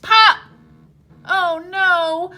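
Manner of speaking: normal talking voice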